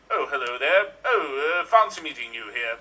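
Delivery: Picard. Acted. Improviser greeting the other captain in the Nexus, low captain voice. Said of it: "My name is Picard".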